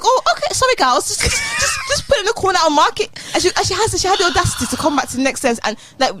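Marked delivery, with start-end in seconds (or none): 0.0-3.1 hoge, gehaaste nagespeelde lerares; mock flustered teacher. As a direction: speel de lerares hoog en gehaast na. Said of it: "She's like".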